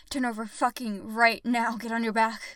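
loud, insistent order; forceful command. Acted. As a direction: raise the voice to demand obedience at once. Repeats an order more forcefully.

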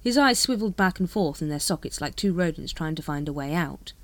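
usual speaking voice

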